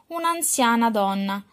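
usual speaking voice

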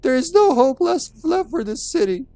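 very high, squeaky falsetto diary reading; absurdly high voice, passed off as dignified; mock dignified voice. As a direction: read it as a hammed-up teenager in falsetto. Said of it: "this is what his voice would sound like".

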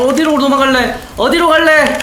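cackling villain taunts, slow and high; mad scientist. Pretend. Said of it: "mad scientist laugh while chasing".

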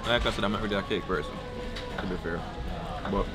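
ordinary talk, nothing marked

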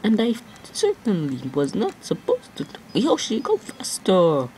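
hammed-up squeaky warning, slowing at the end; silly high voice. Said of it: "Why am I talking like this?".

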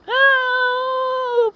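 long high-pitched "oh" of dismay; hammed-up high moan of dismay; mock wail. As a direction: hold one very high wail. Wail at foreseen ruined wedding.

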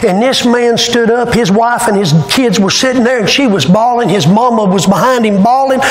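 ordinary delivery

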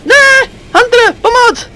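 high loud call for help when pinned; panicked cry for help. Real, not acted. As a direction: yell for help as the Hunter grabs you. Pinned by a Hunter, calling.

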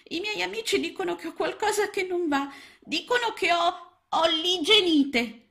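very high, small frog voice, upset; a tiny frog coming home hurt; upset little frog. A narrator's reading. Little frog calling aunt and uncle after being teased, upset. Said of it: "tornava a casa piangendo".

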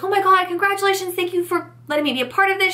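high, fast, thrilled gush; imitating a sweet excited clerk gushing congratulations. Clerk congratulating the mum-to-be, in a high, fast, excited gush. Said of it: "She was super sweet, like, super excited".

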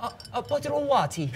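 soft, quick copy ending "water" on "tee"; badly copied British "water" as "wa-tee"; attempted British accent. Shown as a demonstration. Repeating after the Brit, failed British "water".

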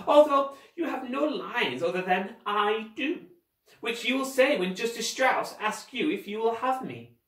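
pompous high-pitched guardian; scheming villain. Acted. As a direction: instruct like a pompous stage director.

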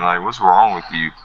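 ordinary delivery

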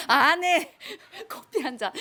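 laughing as she says yes to the coffee order; chuckling through an obliging answer; laughing reply. Retold or imitated. Counselor laughing while agreeing to make the gangster coffee.

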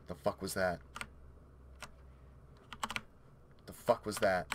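low, soft and wary; hushed alarm. Real, not acted. Player hearing a noise in game, hushed alarm. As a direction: mutter low, listening for danger.

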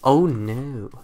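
normal talking voice

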